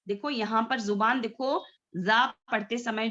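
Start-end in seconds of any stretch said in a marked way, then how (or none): none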